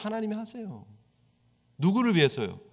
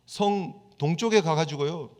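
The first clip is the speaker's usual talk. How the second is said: normal talking voice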